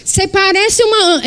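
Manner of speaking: high, slow mocking voice; mocking gossip. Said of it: "Eu ouvi muito na minha vida dizer assim".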